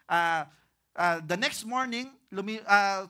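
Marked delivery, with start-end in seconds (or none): none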